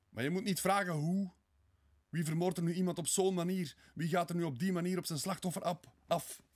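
high, loud, frantic questioning; agitated protest. Acted. Murderer defends himself to Sonja, agitated protest. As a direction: burst out in feverish self-defence.

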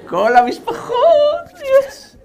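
squealed high through laughter, half crying; laugh-cry at the crowd of guests; laughing mock despair. Relatives flood in for holiday.

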